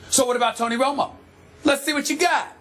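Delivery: sarcastic. Real, not acted. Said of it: "So now you're sounding sarcastic".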